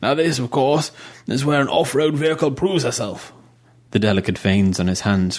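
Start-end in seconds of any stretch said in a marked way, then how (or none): 0.0-3.3 lightly voiced old major.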